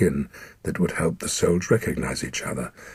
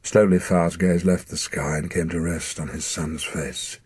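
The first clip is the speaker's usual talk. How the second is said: plain reading voice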